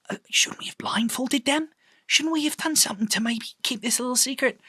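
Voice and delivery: a nervous whisper to Albert about blindfolds. Acted. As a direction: hiss the worry under your breath. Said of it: "You hear Reese whispering".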